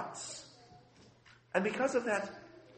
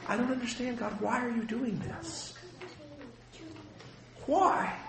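higher, pleading cry of why; anguished plea to God; 7 semitones higher, 5 dB quieter than usual. Retold or imitated. Pastor grieving with his people, crying out high to God. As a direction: ask God why as if your heart is breaking. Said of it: "and I'm crying, I'm going".

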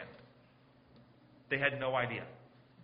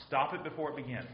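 normal talking voice

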